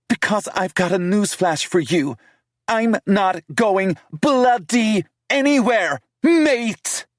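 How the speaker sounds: defiant British woman with a slow, heavy finish on anywhere; British accent. Acted. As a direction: fling it out in a fierce British accent. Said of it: "Bloody mate? Was she taking the piss".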